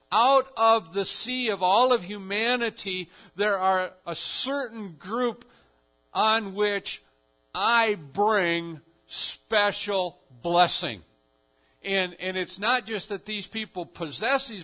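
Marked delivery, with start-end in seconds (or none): 0.1-11.0 louder, slow solemn proclamation; a declared blessing, drawn out; voicing Jesus.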